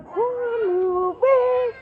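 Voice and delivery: high, loud, slow mock crying; mock horror wail. Mocking the others' horror movie reaction, slow wailing. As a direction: drag it out like a scared kid. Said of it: "They're like".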